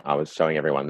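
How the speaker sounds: ordinary delivery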